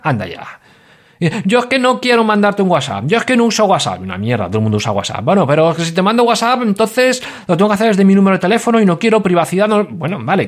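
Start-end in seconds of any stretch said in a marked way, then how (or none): 1.4-4.0 higher, mocking stubborn voice; hammed-up stubborn listener, higher; mock listener excuse. 5.2-9.8 higher mocking protest; hammed-up fussy listener, higher; mock listener excuse.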